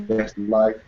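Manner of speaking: ordinary talk, nothing marked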